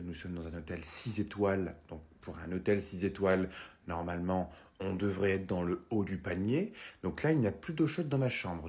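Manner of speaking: low, slow, quiet outrage; a haughty guest who refuses a cold shower; indignant hotel guest. Acted. Guest complaining to the receptionist, low and slowly indignant.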